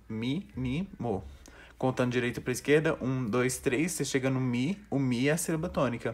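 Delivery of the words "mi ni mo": "Mi, ni, mo" split into three separate syllables. Shown as a demonstration. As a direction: pause after each syllable: "Mi, ni, mo". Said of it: "Você divide como em sílabas".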